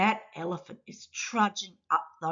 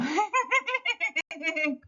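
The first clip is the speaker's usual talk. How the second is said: squeaky high-pitched cartoon giggle; a burst of high little laughter; 12 semitones higher, 7 dB quieter than usual. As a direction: giggle high and bright like a tiny creature. Character laughs at rooster joke.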